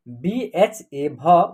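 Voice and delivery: very slow, letter by letter spelling. Shown as a demonstration. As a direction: spell each letter out slowly for the class.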